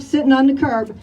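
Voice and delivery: ordinary delivery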